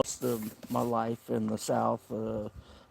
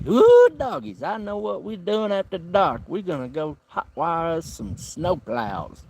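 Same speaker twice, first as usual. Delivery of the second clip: high, loud country hoot, then settling down; hillbilly whoop; 6 semitones higher, 8 dB louder than usual. Retold or imitated. Standing on the bus to coworkers, a high loud hillbilly whoop. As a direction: whoop it up like a rowdy good ol' boy. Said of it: "stand up and say".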